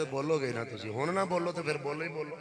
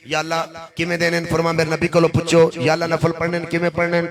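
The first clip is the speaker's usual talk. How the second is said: loud, driving call-and-answer sermon; loud preacher's refrain; full-force preaching; 4 semitones higher, 16 dB louder than usual. Acted. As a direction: hammer each question home.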